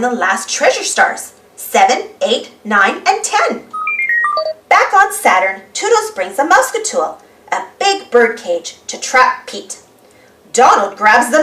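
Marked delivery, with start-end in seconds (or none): none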